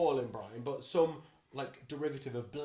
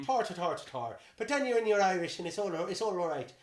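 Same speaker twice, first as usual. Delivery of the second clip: leprechaun-esque Irish; hammed-up, lilting Irish accent; 4 semitones higher, 5 dB louder than usual. Shown as a demonstration. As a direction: launch into a cartoon Irish brogue. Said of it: "my Irish, you just start".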